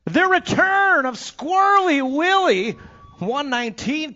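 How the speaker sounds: high, loud, slow showman announcement; big announcer call. Real, not acted. Announcer welcoming back a rider, soaring showman call. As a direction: sell the name like a ring announcer.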